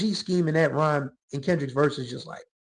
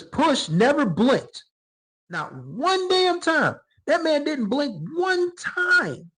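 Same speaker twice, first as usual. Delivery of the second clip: drawn out slowly, voice climbing much higher in awe; slow amazed emphasis; 9 semitones higher, much slower than usual. Real, not acted. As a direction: stretch every word, stunned he never blinked. Amazed at the unblinking performance.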